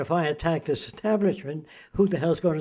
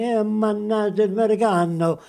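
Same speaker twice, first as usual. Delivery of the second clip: animated mock-Italian chatter, higher pitched; Italian accent; 4 semitones higher, 3 dB louder than usual. Speaker mimics people in Italy, higher-pitched Italian accent. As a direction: imitate an Italian talker. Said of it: "go to Italy and say".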